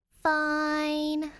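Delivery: high, loud drawn-out fine; exasperated fine. Acted. Danny caving to the cop, loud and exasperated. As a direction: give in with a huff.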